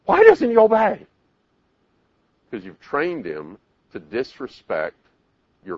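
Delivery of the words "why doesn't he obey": high, loud mock wail; hammed-up frustrated mother; exasperated mother. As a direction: cry it out in frustration. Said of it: "parent's yelling and screaming".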